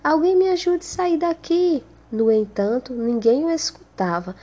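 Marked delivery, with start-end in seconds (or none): 0.0-1.8 high-pitched acted plea, only mildly raised volume; cry for help. A narrator's reading. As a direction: call out for help as a trapped character, pitched well up. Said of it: "Então começou a gritar". (grito de socorro encenado, em voz aguda)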